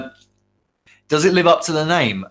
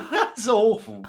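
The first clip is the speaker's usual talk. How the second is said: high, slow laughing groan; 10 semitones higher, 3 dB quieter, much slower than usual. Real, not acted. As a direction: laugh it out in mock disgust. Laughing at pun name.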